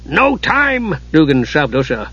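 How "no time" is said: high, impatient character cry; high-pitched frantic refusal; urgent cry. A narrator's reading. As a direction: cut them off in a high, urgent voice. Dugan urgently hurrying Usha along.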